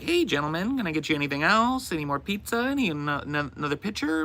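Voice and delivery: lightly voiced waitress asking the table. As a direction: play the friendly waitress up high. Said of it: "she was like".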